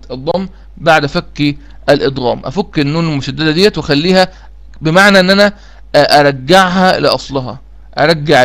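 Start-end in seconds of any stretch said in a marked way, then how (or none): none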